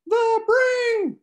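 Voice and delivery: squeaky, very high and loud falsetto; loud chipmunk-style falsetto squeal. Acted. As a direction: shriek it like a cartoon chipmunk. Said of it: "Alfred and the Chipmunks falsetto".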